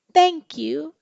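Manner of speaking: bright burst of thanks from the giraffe; grateful giraffe. Acted. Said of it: "said the giraffe".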